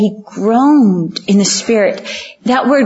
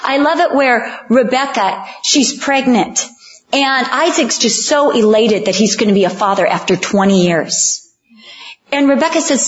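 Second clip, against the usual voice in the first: normal talking voice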